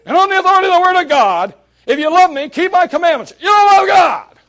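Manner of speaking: loud, high condemning shout; mock preacher shout. Preacher mocking judgmental preachers with a loud, high shout. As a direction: hammer him with the verse, yelling. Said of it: "I look at a man and I say to him".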